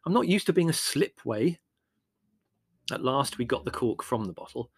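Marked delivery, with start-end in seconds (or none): 0.0-1.6 exasperated, loud and high; irritable. A narrator's reading. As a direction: hurry them along with raised, impatient pitch. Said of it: "asked Larry irritably".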